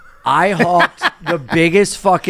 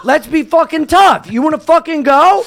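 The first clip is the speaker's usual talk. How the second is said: high, worked-up goading; heated mock tough-guy dare; retold fight challenge; 8 semitones higher than usual. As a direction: re-enact squaring up for a street fight.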